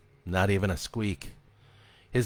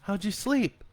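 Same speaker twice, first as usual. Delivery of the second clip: higher, light mother's voice; a mom asking casually; gentle mom; 12 semitones higher than usual. A narrator's reading. Mother asks her son how he slept, lightly.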